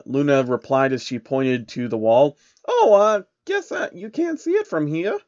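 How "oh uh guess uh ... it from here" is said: high, bashful princess voice; a shy pony princess catching her manners; high and a bit flustered. Acted. Luna voiced.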